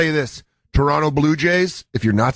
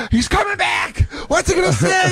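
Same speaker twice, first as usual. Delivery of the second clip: gasp, then much higher, crying-style panic; mock panicked gasp. Podcaster mocking the hit man, whiny mock panic. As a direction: gasp and whimper like a wrestler begging sympathy. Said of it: "he's like".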